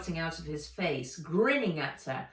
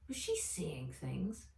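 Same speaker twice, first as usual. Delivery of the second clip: whisper; 8 dB quieter than usual. A narrator's reading. Nat stunned by the book.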